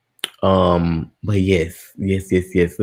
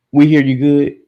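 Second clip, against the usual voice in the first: higher, louder check with chat; calling out; 6 semitones higher, 6 dB louder than usual. Real, not acted. Streamer checks with chat whether audio works, loud. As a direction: shout the question to the room.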